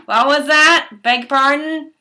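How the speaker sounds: hammed-up staff member demanding a repeat; loud incredulous asking. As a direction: call out as if you misheard. Staff not hearing her answer.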